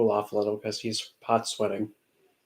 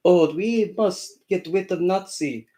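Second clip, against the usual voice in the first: slow, higher mock Russian accent; 6 semitones higher, slower than usual. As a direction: parody a Russian official's accent, slowly. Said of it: "I'm gonna try and do the accent".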